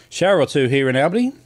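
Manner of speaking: giggling through the words; breaking into laughter mid-read; laughing. Real, not acted.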